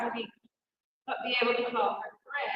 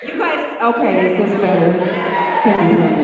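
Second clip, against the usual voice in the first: much louder, projecting to the room; calling out; 5 semitones lower, 18 dB louder, faster than usual. Real, not acted. New speaker checking the room can hear her, calling out loudly.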